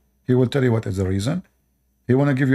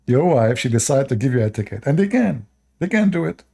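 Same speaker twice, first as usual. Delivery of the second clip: normal talking voice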